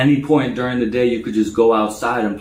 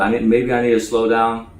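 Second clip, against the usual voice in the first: normal talking voice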